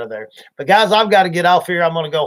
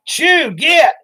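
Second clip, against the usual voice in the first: sharp high shout at the cows; shooing cows; 10 semitones higher, 4 dB louder than usual. Retold or imitated. Treed in a tree, shooing circling cows with a shout. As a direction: shoo the cows off, hollering up high. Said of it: "we was like".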